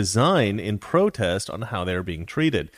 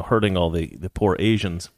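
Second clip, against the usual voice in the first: ordinary delivery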